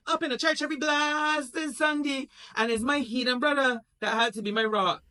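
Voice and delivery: high, loud bitter rant; high indignant complaint; Michael ranting. Acted. Michael rants about mother.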